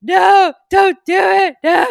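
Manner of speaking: loud, high pleading cry; desperate yelling plea; pleading shout. Acted. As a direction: beg him to stop at full voice. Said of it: "Telegonus is like".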